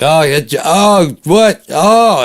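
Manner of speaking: high, loud fake shock at being passed; overacted astonishment, high and loud; hammed-up mock surprise. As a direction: squeal it like a dad pretending to lose. Mock losing the race on purpose.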